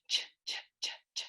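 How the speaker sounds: "ch" as a soft breathy sound, repeated. Shown as a demonstration. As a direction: hiss out "ch, ch, ch". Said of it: "C H always says ch".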